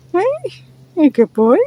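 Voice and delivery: high, fond pet voice; sweet dog praise; praising a dog. Real, not acted.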